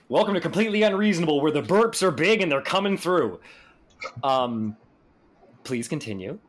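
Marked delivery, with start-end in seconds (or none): 0.1-3.4 fast, high show-host intro; announcer voice. Pretend. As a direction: hype it like a radio announcer. Mock podcast intro after a burp.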